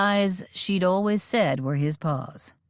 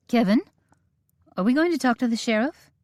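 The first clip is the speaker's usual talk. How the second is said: higher, rushed question; a woman cutting in, higher and fast; eager interruption; 7 semitones higher, faster than usual. A narrator's reading. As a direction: break in high and hurried. Molly interrupts anxiously.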